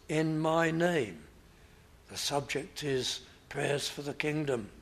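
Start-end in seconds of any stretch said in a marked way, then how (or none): 0.1-1.2 "in my name" slowed and weighted; stress on in my name. Shown as a demonstration. Pastor stressing "in my name" slowly for the congregation. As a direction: lean on "in my name", drawn out. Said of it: "put the emphasis on the words".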